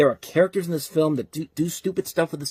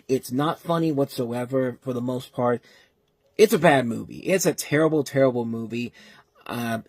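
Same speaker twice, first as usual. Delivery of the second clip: ordinary delivery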